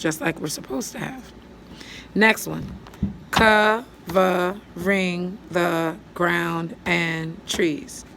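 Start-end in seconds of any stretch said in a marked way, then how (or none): 3.3-8.0 slow syllable reading. Shown as a demonstration. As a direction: stretch the words out while counting syllables. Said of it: "That's seven".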